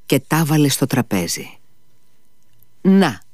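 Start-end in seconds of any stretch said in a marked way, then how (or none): none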